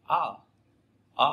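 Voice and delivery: short "Á" from the throat, no Vietnamese rising tone; "Á" as a relaxed, throaty English vowel. Shown as a demonstration. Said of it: "cổ họng nhé".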